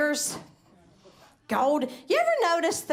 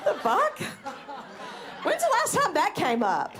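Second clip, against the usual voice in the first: mock disbelief; 2 semitones higher than usual. Real, not acted. Baffled aside.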